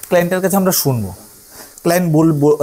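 usual speaking voice

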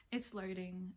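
ordinary delivery

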